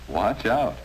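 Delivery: ordinary talk, nothing marked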